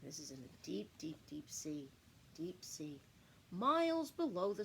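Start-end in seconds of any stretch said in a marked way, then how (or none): 0.0-3.0 hushed deep sea. Acted. Spooky deep sea intro.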